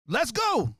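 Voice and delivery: high-pitched call to fight; re-enacted fired-up cry; retold fight challenge. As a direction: throw out a challenge to the whole group.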